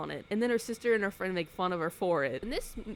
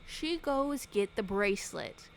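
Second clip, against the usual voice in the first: usual speaking voice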